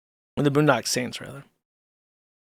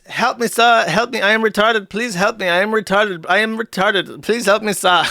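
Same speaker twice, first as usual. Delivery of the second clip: higher mock Indian accent, pleading; 4 semitones higher than usual. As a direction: beg for help in a put-on Indian accent. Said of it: "My Indian voice".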